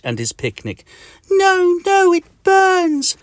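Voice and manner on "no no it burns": very high, loud squeal; agonised wail. Acted. Mister Itch is burned by the cream, high agonised wail. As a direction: wail it in mock agony.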